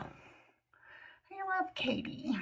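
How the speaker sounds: slow, soft whispered aside. Real, not acted. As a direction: breathe it as a secret aside. Aside about a caller.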